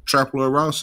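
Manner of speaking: ordinary delivery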